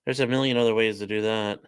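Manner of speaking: ordinary talk, nothing marked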